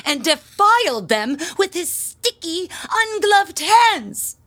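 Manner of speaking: appalled, stretched-out disgust. Acted. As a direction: drag out ungloved hands in horror. Horrified at boy's touch.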